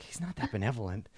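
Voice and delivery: a one-eyed kobold griping quietly; grumbling aside. Acted. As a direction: mutter it under your breath.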